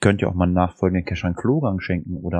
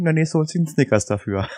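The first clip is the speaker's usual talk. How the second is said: laughing; 6 semitones higher than usual. Real, not acted. Joke about toilet vouchers.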